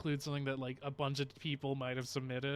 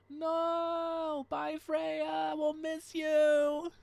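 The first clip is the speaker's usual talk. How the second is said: high, drawn-out playful wail; mock-sad farewell; 12 semitones higher, much slower than usual. Streamer seeing off a departing viewer, mock-sad wail. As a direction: wail goodbye in a much higher voice, stretched long.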